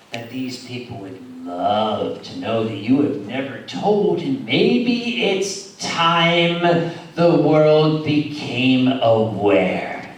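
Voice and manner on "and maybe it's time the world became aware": dramatic slow. Acted. Dramatic teasing announcement.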